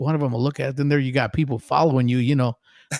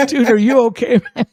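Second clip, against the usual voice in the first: high, loud incredulous concern; retold alarm; 11 semitones higher, 7 dB louder than usual. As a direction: blurt it out, checking on a frantic fan. Retold alarmed reply to shaking fan.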